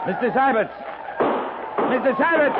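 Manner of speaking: shouting a name across the canyon; calling out. Acted. Captain calling his lieutenant during a firefight, shouting. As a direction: call him from far off, urgent.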